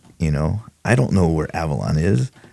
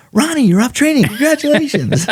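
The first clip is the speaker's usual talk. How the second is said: much higher, louder cheerful announcement; hearty congratulations; 5 dB louder than usual. Retold or imitated. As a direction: break the news to the rookie with a big grin. Senior deputy announces trainee is done.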